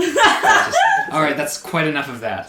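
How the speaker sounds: stiff line through laughter; laughing. Acted. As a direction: sour knight cracking up. DM voices sour Alder, laughing.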